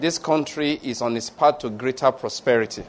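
measured, rolling pronouncement of blessing; preaching blessing. Acted. Pastor pronounces blessings over the congregation, measured and rolling. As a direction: bless the flock with steady authority.

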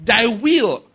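loud, slow declaration in Jesus' name; preaching at full force. Acted. Pastor declaring victory over a marriage, loud preaching. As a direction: proclaim it over the house like a decree.

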